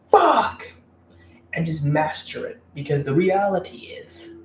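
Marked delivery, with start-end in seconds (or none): 0.1-0.7 high, loud cry of frustration; one explosive, high-pitched shout; frustrated yell. Acted. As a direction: yell it out high, cursing a rejection. Acting out frustration at rejection.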